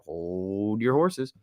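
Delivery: slow, odd character voice; mock character warning; put-on voice catchphrase. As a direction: stretch horses in a put-on voice. Repeats catchphrase in other voice.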